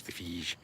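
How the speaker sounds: phage with long "ee" and soft zh; trying out pronunciations. Shown as a demonstration. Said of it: "I don't know what the hell they call it".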